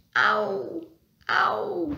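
high, drawn-out storybook groan; acted high moan of pain. A narrator's reading. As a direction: moan it like you just got hurt. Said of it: "kreunt hij".

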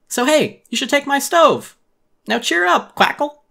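put-on chirpy upbeat duck girl; Pate the duck. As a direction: gush like a perky cartoon duck.

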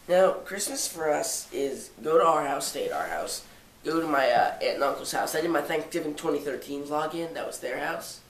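ordinary talk

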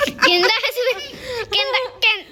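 laughing. Real, not acted. Laughing retelling son's words.

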